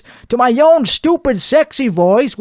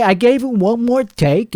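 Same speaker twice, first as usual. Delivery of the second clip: ordinary talk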